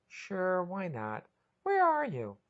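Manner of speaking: sighing, then high question; weary but willing; resigned. A narrator's reading. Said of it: "Patty sighed".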